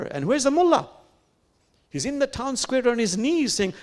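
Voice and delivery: storyteller building suspense; rising excitement. A narrator's reading. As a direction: build toward the punchline.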